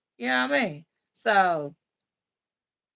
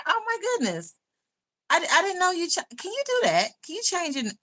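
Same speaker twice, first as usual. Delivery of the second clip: amazed; 10 semitones higher than usual. Real, not acted. Learning usernames can change.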